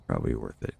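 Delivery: a quiet low echo of "Probably worth it"; low mutter. Real, not acted. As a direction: drop low and mumble it again. Repeats phrase much lower.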